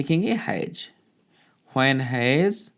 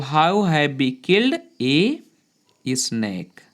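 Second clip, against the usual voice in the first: slow dictation; much slower than usual. Shown as a demonstration. Teacher dictating a sentence.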